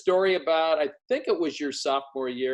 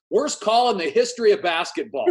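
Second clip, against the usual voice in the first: exaggerated outrage; 7 semitones higher, 5 dB louder than usual. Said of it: "And he goes".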